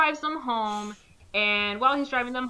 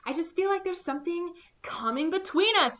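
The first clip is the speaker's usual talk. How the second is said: high, mock-tender lover voice; mock sappy lover; 10 semitones higher than usual. Friend retelling a cheesy movie, high mock-tender lover voice. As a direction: ham up a sappy romantic line.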